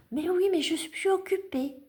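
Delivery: high-pitched pet-talk voice; talking to a cat. Real, not acted. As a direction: answer a pestering cat in a high, sing-out voice. Answering a cat wanting attention.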